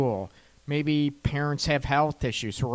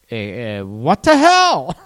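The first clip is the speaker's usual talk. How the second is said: exasperated; 2 semitones lower, 7 dB louder than usual. Retold or imitated. Acted exasperated reaction.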